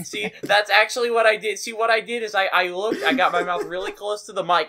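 loud, high, eager telling; excited confession. Real, not acted. Gamer owning up to his mic trick, excited confession. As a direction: burst in, thrilled to confess.

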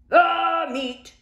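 crew roar. Acted. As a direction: roar it hungrily. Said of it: "we all roared".

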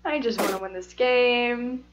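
soft, worn-out plea; tired. Real, not acted. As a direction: let it out quietly, drained after the rant. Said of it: "so tired".

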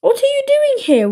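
high-pitched startled question; alarmed grandma. A narrator's reading. Grandma finding Willy in her house, shocked. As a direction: demand it in shock, pitched right up.

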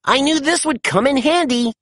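high, pleased character voice; proud of his own idea, voiced up high. A narrator's reading. Pup proudly showing off his find, high and pleased. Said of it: "he said proudly".

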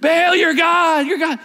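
high, very slow, loud shout; desperate yell. Retold or imitated. Prophets crying out to Baal, drawn out. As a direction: stretch the cry out.

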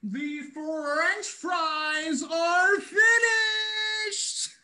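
high, hugely drawn-out announcement; triumphant calling out. Acted. Brother calling that the fries are finished, long triumphant holler. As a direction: stretch every word out, pitched high.

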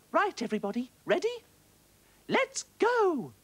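high, loud rallying call; rally the whole shoal to charge; shouting little fish. A narrator's reading. Little fish shouting to the other fish, high and loud. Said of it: "she shouted".

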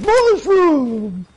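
high, loud, hammed-up cry; crazed mock exclamation. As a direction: imitate a delirious worshipper crying out. Acting out crazed cactus-juice worship.

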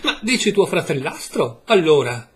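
half-angry cry with a laugh in it; almost angry shout. A narrator's reading. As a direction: blurt it out in near anger. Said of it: "gli gridai quasi con ira".

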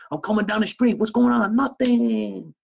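high-pitched, hammed-up hello; acted street greeting. Imagined soldier greets people down the street, high and hammed-up.

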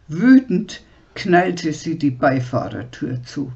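ordinary delivery, nothing marked

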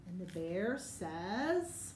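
drawn-out slow lead-in, rising to invite the answer; slow prompting. Shown as a demonstration. Teacher slows down to prompt children to answer. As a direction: stretch it out so the kids jump in. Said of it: "what's he say? Your turn".

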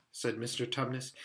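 usual speaking voice

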